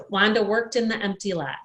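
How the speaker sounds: usual speaking voice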